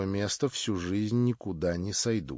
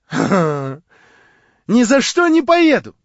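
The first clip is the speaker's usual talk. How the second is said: much higher, louder, very slow; Marya refusing; 10 semitones higher, 13 dB louder, much slower than usual. A narrator's reading. Marya refuses to go with him, high and slow. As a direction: drag out the refusal in her high voice.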